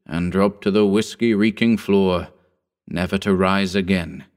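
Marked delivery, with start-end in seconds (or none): none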